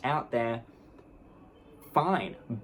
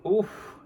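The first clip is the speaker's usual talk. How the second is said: exasperated oof; 8 semitones higher, 4 dB louder than usual. Real, not acted. Exasperated sound.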